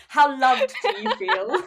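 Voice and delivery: laughing tease. Real, not acted. Teasing the host, laughing.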